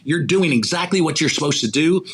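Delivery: ordinary talk, nothing marked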